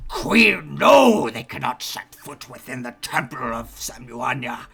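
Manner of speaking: growled, loud protest from a creature; guttural growl. Acted. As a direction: roar the refusal through a guttural growl. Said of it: "a deep guttural growl".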